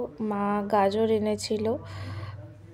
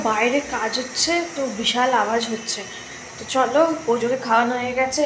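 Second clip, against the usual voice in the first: ordinary talk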